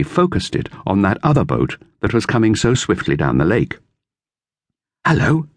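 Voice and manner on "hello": higher, lightly voiced boy; boy's voice, lifted higher; Dick. A narrator's reading. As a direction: read it as young Dick. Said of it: "he said".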